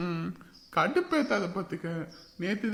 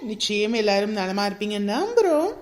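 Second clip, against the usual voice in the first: a louder announcer who slows right down on the opening hello; announcer read; 6 dB louder than usual. Acted. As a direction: greet the audience like a show host, loud and proud. Announcer greeting and birthday wishes.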